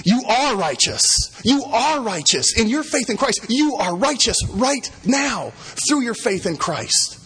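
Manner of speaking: you are righteous, ringing out; emphatic proclamation. Real, not acted. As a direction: preach it with conviction. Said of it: "gospel application".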